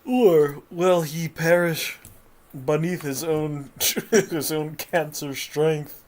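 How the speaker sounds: loud dramatic episode-ending announcer; anime narrator. Acted. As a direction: hype the next-episode cliffhanger.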